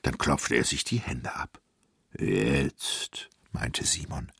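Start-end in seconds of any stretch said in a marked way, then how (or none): 2.2-3.3 deep giant voice; slow big-giant word; satisfied giant. A narrator's reading. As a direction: draw it out low like a giant. Giant speaks in story.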